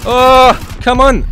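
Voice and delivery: sudden loud outburst; excited shout. Real, not acted. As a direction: yell it in the heat of play. Frustrated moment in firefight.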